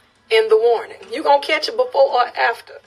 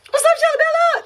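excited greeting. Real, not acted. Greeting a viewer.

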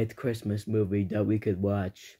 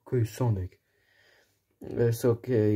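normal talking voice

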